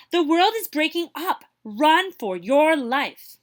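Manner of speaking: loud, high alarm, slowing on the last words; panicked warning. Acted. Panicked hare warns others.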